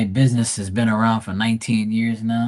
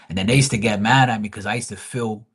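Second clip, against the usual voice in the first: usual speaking voice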